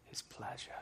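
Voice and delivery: a hint of a Scottish accent, fading soft at the end; light Scottish accent. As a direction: quote the film line in a touch of Scottish brogue. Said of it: "You heard my Scottish accent come out a little bit there".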